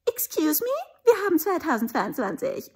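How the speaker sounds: mock teen voice. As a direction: snap it off like a cheeky teen. Mocking uninspired youth.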